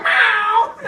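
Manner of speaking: loud high mock meow; playful imitated cat meow. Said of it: "I'd be like".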